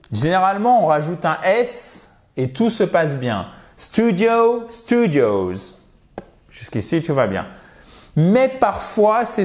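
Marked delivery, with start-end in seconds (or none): 3.8-5.8 drawn-out English STUDIO then STUDIOS, high then falling; model pronunciation. Shown as a demonstration. Teacher models singular then plural for students, demonstrative pronunciation. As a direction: model the word pair slowly for learners. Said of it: "j'insiste beaucoup sur la prononciation".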